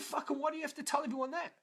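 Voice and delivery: high-pitched, fast, exasperated comeback; retold protest. Student to mentor shaming him before class, exasperated protest. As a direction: object quickly, pitched right up, embarrassed.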